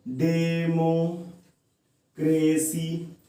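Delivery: Democracy very slow, long pause in the middle; democracy sounded out in drawn, even beats; split into syllables. Shown as a demonstration. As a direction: stretch each syllable of democracy and break it halfway. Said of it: "तीन बार में pronounce कर पा रहे हैं".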